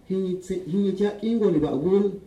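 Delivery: normal talking voice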